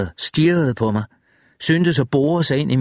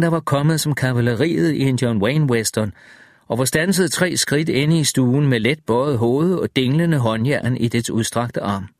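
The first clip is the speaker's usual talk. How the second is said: plain reading voice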